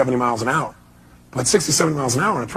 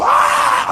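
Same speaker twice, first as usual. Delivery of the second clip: very high unvoiced shriek of panic; a sudden airy scream as the car skids; mock terrified shriek; retold panic scream. Car skidding toward the wall.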